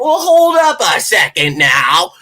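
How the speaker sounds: imitating copycats acting wise, drawn out; mock know-it-all. Mocking fans who repeat his takes, slow smug voice.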